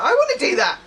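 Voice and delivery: mock-eager self quote. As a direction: blurt it out keen and bright. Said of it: "I was like".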